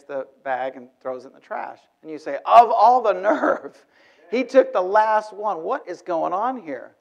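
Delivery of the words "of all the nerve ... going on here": slow, drawn-out huff of indignation, pitched higher and louder; indignant outrage. Acted. At the airport table, fuming at the cookie thief: slow indignant huff. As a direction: let the offended disbelief stretch out. Stranger took the last cookie.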